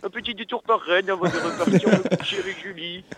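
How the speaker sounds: François Hollande impression. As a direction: play the president, amused. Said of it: "Posez-lui des questions".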